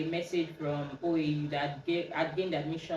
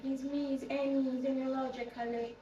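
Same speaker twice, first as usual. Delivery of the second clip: higher, lightly put-on caller voice; higher retold phone voice; friend introducing herself; 8 semitones higher than usual. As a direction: imitate a friend saying who's calling.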